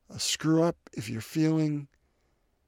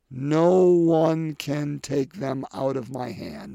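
slow reading; 5 dB louder, much slower than usual. Shown as a demonstration. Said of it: "I love this line".

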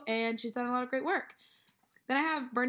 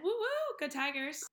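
cheering; 5 semitones higher, slower than usual. Acted. School cheer for Clemson.

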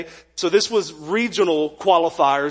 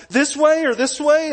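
confused asking; 8 semitones higher than usual. Retold or imitated. Lost runner asking for directions.

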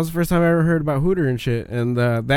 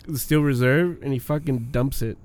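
ordinary talk

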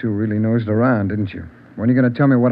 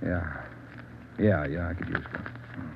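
weary murmur; 7 semitones lower, 14 dB quieter, slower than usual. Acted. Exhausted suspect accepting a cigarette.

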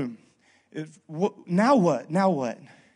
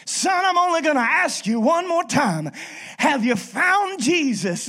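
stern preacher; 8 semitones higher, 4 dB louder than usual. Retold or imitated. Preacher giving Boudreaux a last chance, high and loud. Preacher's final demand in joke.

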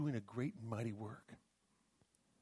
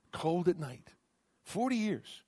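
ordinary talk, nothing marked

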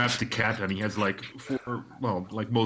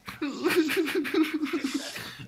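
high acted sobbing wail; high-pitched pretend crying; child's crying. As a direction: wail like a small girl in tears. Girl starts to cry.

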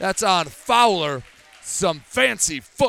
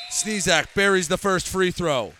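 ordinary talk

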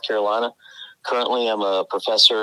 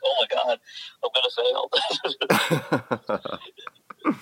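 mock panic; 8 semitones higher, slower than usual. Students panicking at accent.

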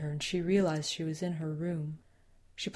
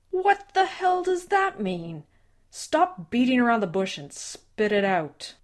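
high, loud, slow outburst; angry; 6 semitones higher, 6 dB louder than usual. Acted. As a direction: bark it at him. Father loses patience.

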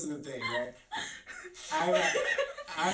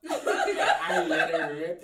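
laughing; 9 dB louder, slower than usual. Real, not acted. Laughing while telling the story.

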